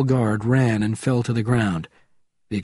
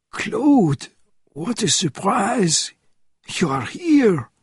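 put-on old man voice; 9 semitones higher, slower than usual. A narrator's reading. Old friend greets over radio.

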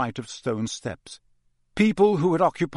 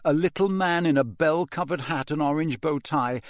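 ordinary delivery, nothing marked

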